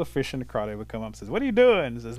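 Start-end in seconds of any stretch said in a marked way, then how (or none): 1.3-1.9 high, loud, nosy call. Acted. Fish and crawdad call up to the turtle, loud and curious. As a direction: pitch it up and shout over to a friend.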